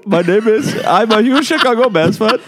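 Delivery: a put-on Shrewsbury accent, hammy. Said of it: "That's a Shrewsbury accent".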